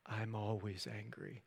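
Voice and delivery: low, slow and very quiet; quietly composed admission, voice dropped; calm. Retold or imitated. Bruce walking toward the destruction, calm and hushed. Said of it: "slowly walks calmly toward the destruction".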